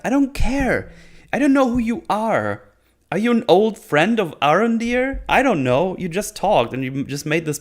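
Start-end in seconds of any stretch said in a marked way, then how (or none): 0.1-6.0 high-pitched, whiny exasperation; exasperated indifference. Retold or imitated. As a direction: protest that you couldn't care less. Rant at unknown dead elf.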